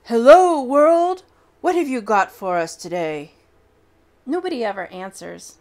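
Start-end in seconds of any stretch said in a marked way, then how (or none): 0.0-3.3 booming, much louder greeting to the world; real loud voice. Acted. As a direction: throw it out loud to the whole world. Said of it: "says in a real loud voice".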